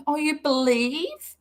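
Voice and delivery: slow, mocking echo of her words; sarcastic mock. Real, not acted. Mocking the post writer's claim, sarcastic and slow. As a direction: scoff at the phrase as you repeat it.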